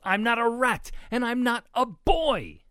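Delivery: high, loud, slowing on the last denial; indignant yell. A narrator's reading. Ruby sets the pigs straight, yelling. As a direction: yell each denial emphatically. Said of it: "Ruby yelled".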